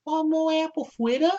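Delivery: high-pitched cartoon voice; chirpy squeaky pony voice; imitating a cartoon pony. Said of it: "She's like".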